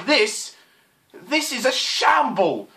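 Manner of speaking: exasperated rant, high and drawn out. Real, not acted. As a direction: build to a high, exasperated curse. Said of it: "I'm ranting".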